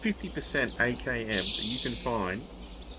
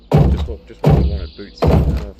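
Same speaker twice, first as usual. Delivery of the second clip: loud sarcastic yell at finding boots; frustrated gamer mock-cheering useless boots; sarcastic shout; 3 semitones lower, 11 dB louder than usual. Real, not acted. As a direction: shout the fake delight, dripping sarcasm. Sarcastic outburst at useless loot.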